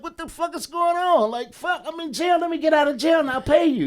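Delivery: hammed-up panicked yelp from jail; retold panic. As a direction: squeal it out in mock panic.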